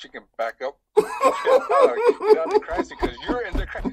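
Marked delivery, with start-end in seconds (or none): 1.0-1.0 high, laughing retort; laughing while quoting. Retold or imitated. Guest quotes police telling him to leave, laughing. 1.1-2.3 loud high burst of laughter. Real, not acted. Guest laughing at his own crime scene blunder, loud laugh. 2.4-3.9 high, laughing retort; laughing while quoting. Retold or imitated. Guest quotes police telling him to leave, laughing.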